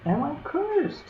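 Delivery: high, thin elf voice; squeaky elf voice. Acted. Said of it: "he said".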